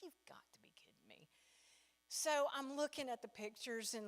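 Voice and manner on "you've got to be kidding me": hushed whisper, no voice; whispered disbelief. Retold or imitated. Reading the neighbor's post, whispered disbelief. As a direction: breathe it out in disbelief. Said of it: "I'm like".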